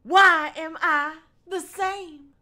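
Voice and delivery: high, slow, hammy wail; interrupting girl. Acted. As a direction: draw out the questions in overwrought high voice. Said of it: "her turn to interrupt me".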